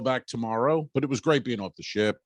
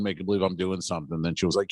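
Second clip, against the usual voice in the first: ordinary talk, nothing marked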